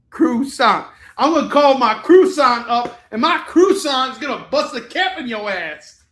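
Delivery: loud, high comic swagger; playful shouted braggadocio; mock tough-guy threat. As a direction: threaten them with hammed-up bravado. Mock tough threat with American croissant.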